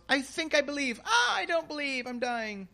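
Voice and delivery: high mock voice of a faltering believer; wavering believer. Preacher mimics a doubter losing faith, in a high mock voice. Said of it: "faith that Jesus talks about that shows up and goes".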